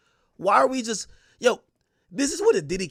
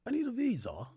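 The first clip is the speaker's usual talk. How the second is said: higher mock girlfriend voice; mock higher imitation of the girl asking for a visa; mimicking his girl; 5 semitones higher, 7 dB quieter than usual. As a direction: pitch it up to imitate her. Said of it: "She's like".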